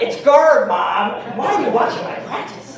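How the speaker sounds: put-on annoyed, a bit embarrassed kid; embarrassed son. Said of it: "My son turns around".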